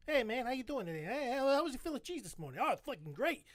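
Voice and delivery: two Philly locals chatting in hammed-up accent; mock Philadelphian banter; Philadelphia accent. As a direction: play both sides in broad Philly accent. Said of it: "that's all Philadelphians talk about".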